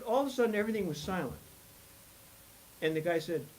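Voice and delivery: usual speaking voice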